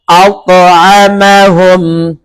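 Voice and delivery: very slow أطعمهم with a bounce on ط; qalqalah on ta. Shown as a demonstration. Teacher gives the example of ta, slow bouncing أطعمهم. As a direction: drop and echo the ط in أطعمهم. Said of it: "এখন ত".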